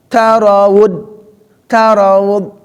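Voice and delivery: slow tarawud, heavy final d; contoh tarawud. Shown as a demonstration. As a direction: tarawud drawn out with a clear d. (tarawud pelan, dal jelas)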